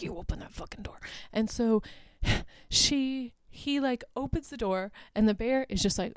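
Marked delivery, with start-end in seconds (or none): 0.0-1.0 fierce whisper. Retold or imitated. Ranger orders guy, retold scene.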